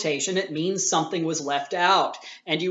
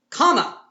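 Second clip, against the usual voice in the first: comma said much higher and louder; pointing out the comma; 9 semitones higher, 7 dB louder than usual. Shown as a demonstration. Teacher stresses the missing comma to the class, punched. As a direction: land hard on the word comma. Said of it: "what comes after the comma?".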